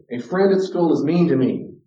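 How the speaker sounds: mock aggrieved schoolchild; complaining child. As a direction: tattle like a wronged kid. Voicing complaining child example.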